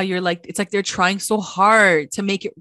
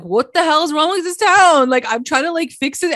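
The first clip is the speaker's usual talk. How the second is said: imitating a desperate, exasperated shout, higher and louder; exasperated outburst; 8 semitones higher, 5 dB louder than usual. Archie fed up with his corrupt town, exasperated mock yell. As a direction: throw up your hands at the whole town. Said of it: "you hear the exhaustion in his voice and, like, the desperation".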